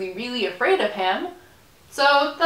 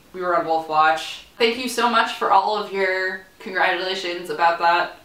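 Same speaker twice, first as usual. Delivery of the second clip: ordinary delivery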